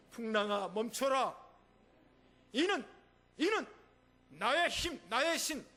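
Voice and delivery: high, loud sermon delivery; fervent preaching. Acted. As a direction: pastor voicing Jesus to the sea, preaching with force. Preaching at full force.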